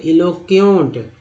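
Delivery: "इलोक्यूंट" slowly with a closing nt; slow recap of "इलोक्यूंट"; model pronunciation. Shown as a demonstration. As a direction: say "इलोक्यूंट" as i-lo-kyont, drawn out. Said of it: "ई एन टी को एंट बोलते हैं".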